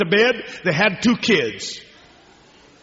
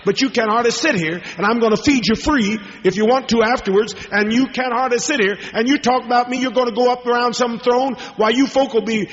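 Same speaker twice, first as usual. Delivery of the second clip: raised, driving preacher voice; fervent preaching; 8 semitones higher than usual. Acted. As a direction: press it home to the congregation. Preaching at full force.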